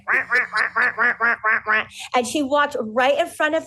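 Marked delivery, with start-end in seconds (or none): 0.1-1.8 mock duck quacks, wah wah; duck quacking. Bride's duck story told to host, imitating quacks. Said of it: "It was like".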